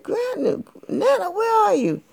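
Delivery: put-on very high sweet girl voice; high little girl. A narrator's reading. Girl answering Grandma at the door. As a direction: answer politely, little and bright.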